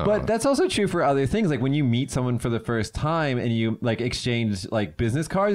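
ordinary talk

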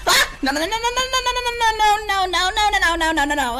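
high rapid panicked no no no; real terror spilling out; frantic high denials. Real, not acted. Jumpscare panic.

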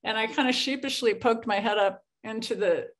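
ordinary delivery

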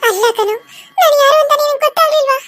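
ordinary talk, nothing marked

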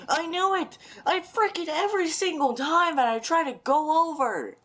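high, loud exasperated shout; frustrated yell. Real, not acted. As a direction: blow up in frustration. Frustrated outburst after dying.

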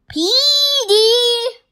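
high, loud call across the distance. Acted. Kitten calling after Petey, loud high call. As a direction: call out to someone far off.

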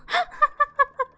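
high, amused burst of giggles; real laugh. Real, not acted. Player amused after Don's smussle, giggling. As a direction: giggle at your own joke.

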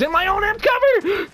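high, loud alarmed outcry; alarmed yell; shocked shout. Real, not acted. Enemy appears inside his cover.

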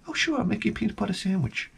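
lightly voiced doting mom; mom answering. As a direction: humor the kid like an indulgent mother.

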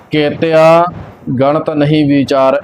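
a clear break right after ਕੇਤਿਆ; pause after ਕੇਤਿਆ. Shown as a demonstration. Teacher showing where to rest, pause after ਕੇਤਿਆ. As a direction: hold ਕੇਤਿਆ, pause, then read on. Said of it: "ਕੇਤਿਆ ਤੇ ਵਿਸ਼ਰਾਮ ਲੱਗਣਾ ਹੈ".

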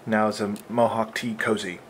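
usual speaking voice

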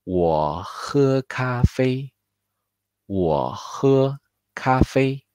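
我喝咖啡 said slowly and clearly, 咖啡 high; careful teacher demo of 我喝咖啡; 慢速示范 我喝咖啡; slow model sentence. Shown as a demonstration. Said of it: "Así que yo bebo café".

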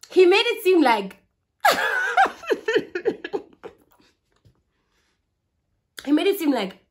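laughing. Real, not acted.

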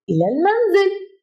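ordinary talk, nothing marked

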